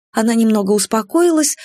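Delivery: usual speaking voice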